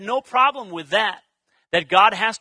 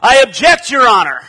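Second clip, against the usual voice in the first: loud, forceful mock lawyer's objection; booming courtroom protest, raised pitch; 3 semitones higher, 12 dB louder than usual. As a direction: call it out dramatically to the judge.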